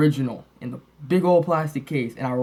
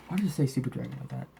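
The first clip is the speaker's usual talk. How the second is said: usual speaking voice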